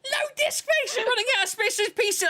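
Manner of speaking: high, fast mocking quote of the popup; mocking error message. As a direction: mimic the error message snidely. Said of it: "Windows Mesh popped, like".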